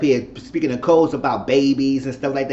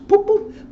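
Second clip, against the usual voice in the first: high mocking boop; 6 dB louder than usual. Mock put-down sound.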